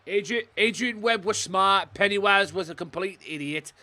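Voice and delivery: gloating. Real, not acted. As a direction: rub the win in. Gloating over loser.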